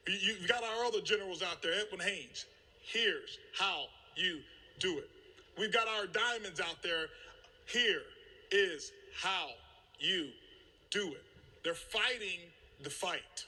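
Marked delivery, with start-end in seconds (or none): 2.8-5.1 "Here's how you do it" slow and low, each word set apart; word-by-word emphasis. Retold or imitated. Voices fellow leaders showing the way. 7.7-11.2 "Here is how you do it" broken by firm pauses after each beat; deliberate leader's instruction, halting between words; word-by-word emphasis. Retold or imitated. Repeats the leaders' line for effect.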